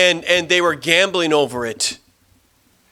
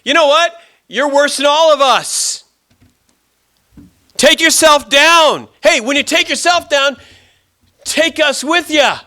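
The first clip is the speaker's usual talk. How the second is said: full-voiced scornful shouting; mocking; 14 semitones higher, 7 dB louder than usual. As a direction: yell taunts at the man on the next cross. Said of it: "he begins to mock and say".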